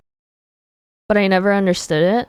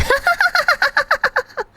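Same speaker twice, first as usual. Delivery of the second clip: sudden high-pitched cackle; squeaky high laugh. Pretend. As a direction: burst into a squeaky giggle. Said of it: "every time I laughed".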